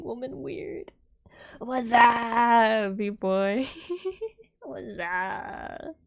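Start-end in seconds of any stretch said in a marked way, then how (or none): 1.6-6.0 louder bro shout-out; mock bro greeting. Imitating a dude calling out to a buddy, loud bro greeting. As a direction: bellow it like a dudebro. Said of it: "a dude that's like".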